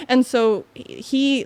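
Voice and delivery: ordinary talk, nothing marked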